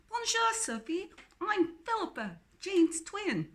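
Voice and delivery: chirpy English twin says hello; strong English accent. Acted. Philippa introducing herself to Sophie in a cheery English accent. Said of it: "her English accent strong".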